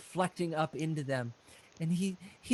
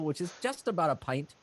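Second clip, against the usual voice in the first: ordinary delivery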